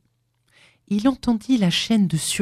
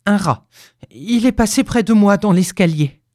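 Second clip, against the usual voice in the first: put-on frightened old man, raised voice; higher, louder, mildly panicked excuse; teeth chattering; 4 semitones higher, 5 dB louder than usual. A narrator's reading. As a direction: blurt it out, rattled. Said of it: "dit-il en claquant des dents".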